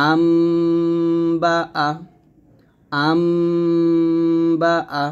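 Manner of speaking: আম with a held, nasal m before বা; change the n into a humming m; nasal m sound. Shown as a demonstration. Teacher shows iqlab with a held nasal m. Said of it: "আন না বলে বলবো আম".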